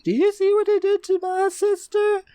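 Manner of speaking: very high-pitched imitation of an upset woman; mock outraged accusation. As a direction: accuse in a strained high put-on voice. Voicing an angry woman confronting Laurie.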